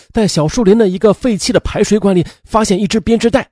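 ordinary delivery, nothing marked

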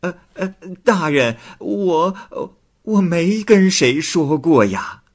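high, stammering woman; nervous denial. Acted. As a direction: falter through the denial. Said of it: "王氏供称". (王氏结结巴巴地否认)

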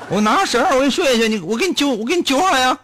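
high calling out from the helicopter; hammed-up rescuer yelling; mock rescuer call. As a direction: shout down to the man in the water. Helicopter rescuer offering rope.

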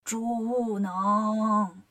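drawn-out, disguised eerie call; pinched-throat call. A narrator's reading. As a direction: squeeze the throat and stretch each syllable. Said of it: "捏着嗓子喊".

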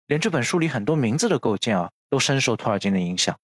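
normal talking voice

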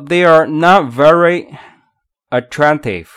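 slow model line, weight on "not" and "attractive"; deliberate demo with "not" standing out; stress on not and attractive. Shown as a demonstration. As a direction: spell out the beat on "not" and "attractive". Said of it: "有两个地方需要重读".

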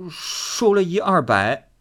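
put-on a cowed boy owning up; meek reply. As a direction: answer meekly, afraid of her. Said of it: "只得唯唯的回答".

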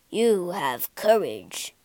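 low, slow monster voice; beast's voice. A narrator's reading. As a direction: speak slowly as the beast, impressed. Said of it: "said the beast".

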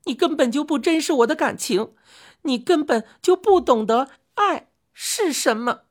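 high-pitched, wounded accusation; tearful outcry. A narrator's reading. Chang'e accuses her husband of not loving her, tearful outcry. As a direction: cry out in hurt, pitched right up. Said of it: "她含泪喊叫说".

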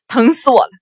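loud, agonised yelp; sudden howl of pain; cry of pain. A narrator's reading. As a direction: break into a pained wail. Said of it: "又是疼得喊了出来".

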